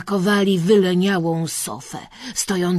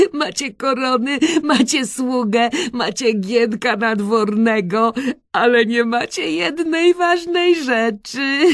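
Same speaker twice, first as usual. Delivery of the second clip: loud, raised teasing through laughs; Kresek bursting with laughter; 5 semitones higher, 6 dB louder than usual. A narrator's reading. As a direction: crow it out between giggles. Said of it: "wybuchnął śmiechem".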